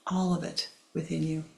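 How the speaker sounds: usual speaking voice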